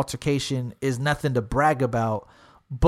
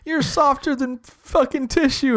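high, drawn-out taunting quote; mocking imitation; 9 semitones higher, slower than usual. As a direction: mimic someone's insult, slow and pitched way up. Mimics an insult thrown at him.

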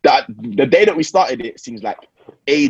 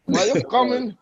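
high-pitched mock yell; high mock shout; 9 semitones higher than usual. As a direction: shout it like the viral clip. Acting the viral clip.

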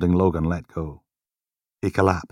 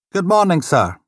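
polite desk sergeant, a bit higher; lightly voiced desk sergeant; 12 semitones higher, 5 dB louder than usual. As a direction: greet him with polite formality.

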